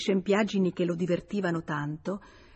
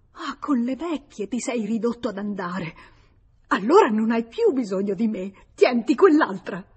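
louder, higher on "tienti quell'altra"; furious jealousy; 4 semitones higher, 6 dB louder than usual. Acted. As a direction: throw him away in anger. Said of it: "balbettava furibonda". (gelosia furiosa, gridata)

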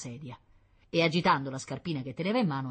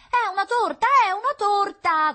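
put-on very high, loud child's wail; little girl yelling in angry frustration; tearful shouting; 11 dB louder than usual. A narrator's reading. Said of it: "continuò a gridare tra i singhiozzi". (grida acute da bambina in pianto)

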